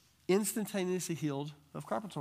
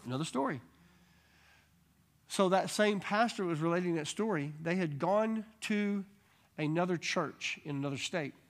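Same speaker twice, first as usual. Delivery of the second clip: usual speaking voice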